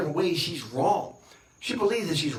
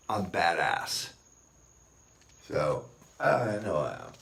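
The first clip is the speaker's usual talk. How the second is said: deadpan boast; 9 semitones lower, slower than usual. Real, not acted. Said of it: "slow low punchline".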